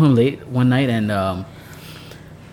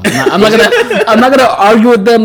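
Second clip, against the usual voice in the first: words pushed out through a laugh, higher and louder; 7 semitones higher, 7 dB louder than usual. Real, not acted. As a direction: laugh your way through the quote. Laughing while quoting himself.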